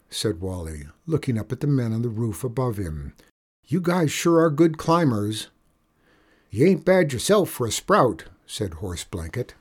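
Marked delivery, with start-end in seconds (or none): 3.7-5.5 put-on higher boy's voice; admiring boy. Boy praising the men above him, admiring. 6.5-8.2 higher, louder cowboy voice; folksy outlaw. Acted. Outlaw on roof praising the boy, jovial.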